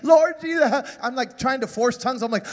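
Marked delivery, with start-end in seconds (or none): 0.0-1.0 high, strained, teary pleading to Jesus; imitating frantic crying prayer. A boy crying in the back of the bank, begging Jesus to save him. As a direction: pray like you think you've been left behind. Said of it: "So I'm crying".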